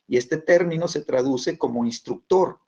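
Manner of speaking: ordinary delivery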